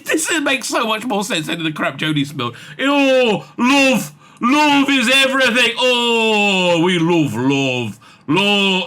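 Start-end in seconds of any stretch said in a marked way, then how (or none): 2.8-8.8 loud, drawn-out mock sentiment; mocking gushing. Reader mocking the Jodie-era love speeches, mocking gushing. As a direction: wail it syrupy, sending up the speech.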